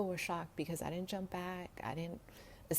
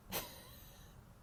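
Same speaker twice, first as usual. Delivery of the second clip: short high laugh; 10 dB quieter than usual. Real, not acted. Laugh before the story.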